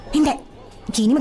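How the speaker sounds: normal talking voice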